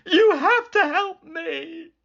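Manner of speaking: high, tearful begging; high, loud crying plea. Acted. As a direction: plead through tears. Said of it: "Sam begged them".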